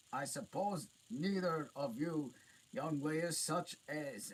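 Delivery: high loud giant, out of breath. A narrator's reading. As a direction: puff it out like a winded giant. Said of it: "said the giant".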